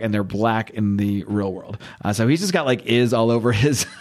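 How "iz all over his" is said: ordinary talk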